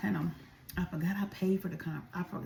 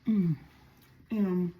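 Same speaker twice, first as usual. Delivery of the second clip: mouth full; 4 dB louder than usual. Real, not acted. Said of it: "Take another bite".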